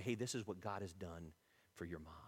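low and very soft; tender testimony. Acted. Mom telling her child what God did, softly. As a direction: confide it quietly, fading at the end.

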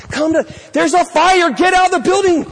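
loud, high, urgent warning to get out; urgent shout. Pretend. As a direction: yell it like the building is on fire. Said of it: "you're imploring people".

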